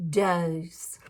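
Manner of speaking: low, drowsy drawn-out word; sleepy. Acted. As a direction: let it fade into sleep. Flea falls asleep.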